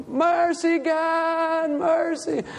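high, sustained wail, acting out agony; retold scream for mercy. Preacher recalling pleading with God on the floor, mock high scream. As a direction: re-enact a pained plea in a high held wail. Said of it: "and I was screaming".

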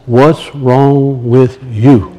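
slow, low, quiet voice of God; drawn-out, soft, low rebuke from within; inner voice. Retold or imitated. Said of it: "that inner voice says".

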